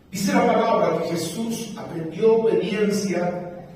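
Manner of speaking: drawn-out verse reading; slow emphatic reading. Shown as a demonstration. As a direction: lay each word down slowly. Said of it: "preacher repeats the verse". (lectura lenta y enfática)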